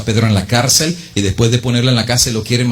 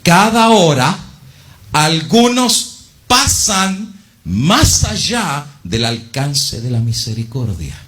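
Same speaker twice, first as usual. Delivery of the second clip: solemn warning; 4 semitones higher, slower than usual. Acted. Preacher reads the warning to listeners, rising then hushed. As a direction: let the warning rise then fall away.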